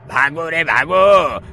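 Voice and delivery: loud, slow drawn-out call to run; urgent call to flee. Acted. Villager tells all to flee.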